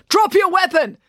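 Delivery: loud, high-pitched shouted order; booming authority. A narrator's reading. Constable orders officer to disarm, booming authority. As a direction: bellow it as a police command. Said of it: "an authoritative male voice boomed".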